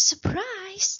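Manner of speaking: high, gasping surprise; surprised voice. Shown as a demonstration. Teacher naming the monster's feeling, high and amazed. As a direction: say it like you just got a shock.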